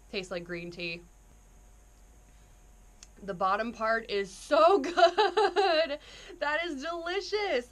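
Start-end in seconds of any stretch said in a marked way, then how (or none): 3.2-7.7 laughing, drawn out with delight; rapturous tasting food. Real, not acted. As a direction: savour it, loud and slow. First taste of the drink.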